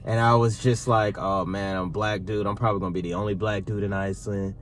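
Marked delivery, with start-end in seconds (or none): none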